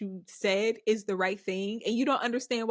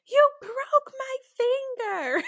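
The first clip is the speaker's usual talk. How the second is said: high, drawn-out mock wail, louder; retold scream; 5 dB louder, slower than usual. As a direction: re-enact a child's slow, high-pitched scream of accusation. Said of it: "well, I screamed".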